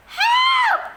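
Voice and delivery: very high, very loud cry for help; screamed. A narrator's reading. Both boys terrified in the dark, screaming. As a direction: scream it in panic. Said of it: "screamed Henry and the lump".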